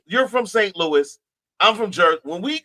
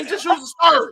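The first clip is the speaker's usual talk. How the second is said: a put-on Saint Louis accent, pitched up; 9 semitones higher than usual. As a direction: play the hometown Saint Louis voice. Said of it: "we put on that accent".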